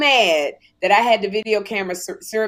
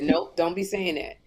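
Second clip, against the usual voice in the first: lower talk-show mentor voice; 4 semitones lower, 3 dB quieter than usual. Retold or imitated. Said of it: "She said".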